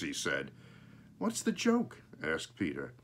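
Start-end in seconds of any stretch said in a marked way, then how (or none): 1.2-2.0 put-on a child's question. Child Peter voiced in story.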